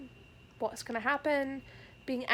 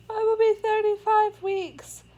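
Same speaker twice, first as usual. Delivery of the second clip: excited; 10 semitones higher, 9 dB louder than usual. Real, not acted. Pregnancy milestone, emotional outburst.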